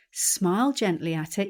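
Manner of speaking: ordinary delivery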